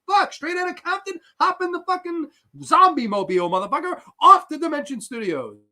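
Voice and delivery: high, hammed-up casting-director voice. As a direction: play a sleazy casting guy picking up an actress. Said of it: "[name] voiced casting scene".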